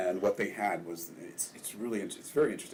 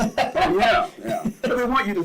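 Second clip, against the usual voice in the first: laughing; 9 dB louder than usual. Real, not acted. Laughs agreeing with host.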